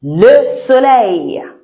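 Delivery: very slow, clear "le soleil"; slow model word. Shown as a demonstration. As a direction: lay out "le soleil" syllable by syllable. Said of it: "Let's start with".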